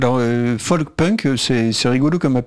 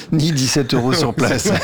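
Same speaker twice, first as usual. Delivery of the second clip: says it through a real laugh, amused; a joke about the price, laughing as he says it; 3 semitones higher than usual. Real, not acted. Joking callback on ticket prices. (en riant franchement)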